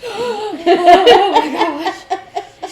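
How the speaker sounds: high, loud startled cry. Retold or imitated. Coworker hearing the child voice again, loud high startled cry. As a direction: jump out of your skin.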